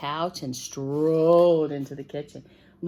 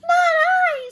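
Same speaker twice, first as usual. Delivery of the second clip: very high tiny mouse voice; squeaky mouse; 8 dB louder than usual. Acted. Voicing the mouse.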